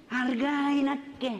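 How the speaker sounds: high, slow mocking wife voice, laughing; laughing put-on wife voice, pitched high. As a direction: tease him like a scornful wife, high and drawn out.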